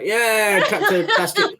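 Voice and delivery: high-pitched sham celebration; mock cheering. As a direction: cheer a feeble payoff with put-on glee. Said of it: "the payoff was so poor".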